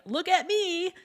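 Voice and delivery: high, showy call for attention; look-at-me shout. Retold or imitated. Self-centred partygoer entering, high and showy. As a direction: grab the room, all about yourself. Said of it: "those who enter a room shouting".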